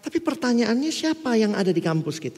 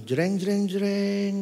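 mock dramatic sting; 2 semitones higher, 4 dB quieter, much slower than usual. Imitates dramatic sound cue.

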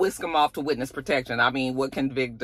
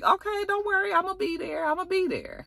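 1 eager high voice; 4 dB quieter than usual. Retold or imitated. Vic eagerly reassures Tommy.

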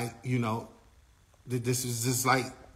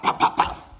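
loud, popping hit sounds; imitated punches; 6 dB louder than usual. Retelling how she hit James, imitated punch noises. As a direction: punch out each bop like a blow.

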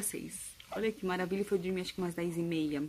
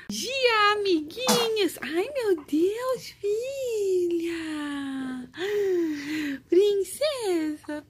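cartoon princess; 11 semitones higher, 7 dB louder, much slower than usual. Real, not acted. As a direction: squeal it like a cartoon princess greeting. Mãe falando com a bebê. (voz aguda de princesa de desenho)

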